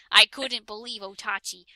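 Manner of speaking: ordinary delivery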